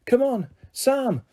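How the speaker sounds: high, slow calls up to her son; shouting. Acted. Vicky shouting up at her son Sam, urging. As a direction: yell for Sam to climb up. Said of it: "Vicky was shouting".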